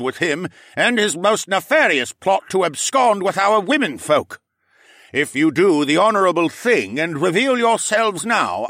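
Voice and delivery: loud, high formal proclamation; commanding lord voice. Acted. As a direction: address the whole court with authority. Lord addresses the court.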